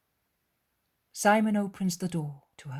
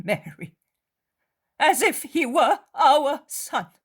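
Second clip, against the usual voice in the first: tearful mother; 7 semitones higher, 7 dB louder than usual. Acted. Mary weeping for Judas and urging prayer. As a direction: speak through sorrow, pleading.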